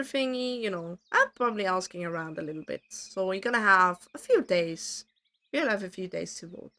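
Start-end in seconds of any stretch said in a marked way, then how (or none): none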